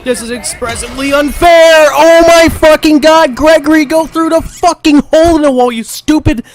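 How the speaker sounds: loud, high screaming insults; raging yell. Real, not acted. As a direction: lose it completely at the game. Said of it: "how many times I fucking raged".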